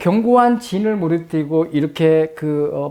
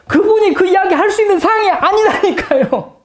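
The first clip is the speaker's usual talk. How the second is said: laughing disbelief; 4 dB louder than usual. Real, not acted.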